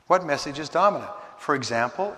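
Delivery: ordinary delivery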